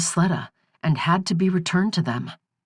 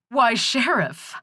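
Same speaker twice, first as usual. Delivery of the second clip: stifling laughter; 7 semitones higher, slower than usual. Acted. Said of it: "stifling her mirth".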